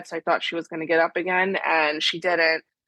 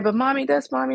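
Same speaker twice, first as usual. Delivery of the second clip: higher-pitched mock whine; imitating crying child; 8 semitones higher, faster than usual. Mother imitates her daughter crying for Mommy, lightly whiny. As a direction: lightly mimic a child's tearful pleading. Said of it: "she'll start crying".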